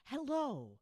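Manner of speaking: ordinary talk, nothing marked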